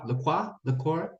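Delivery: LaCroix as "luh-KWAH", then "luh-KOY"; trying out LaCroix endings, softly; unsure pronunciation. Shown as a demonstration.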